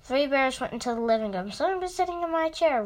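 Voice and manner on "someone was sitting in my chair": higher, fast, lightly voiced father bear; a quick storybook bear roar, barely acted; mild mock roar. A narrator's reading. Father bear sees his chair was used, mild mock roar. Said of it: "roared the father bear".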